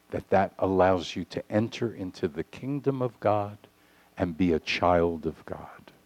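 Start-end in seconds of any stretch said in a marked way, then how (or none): none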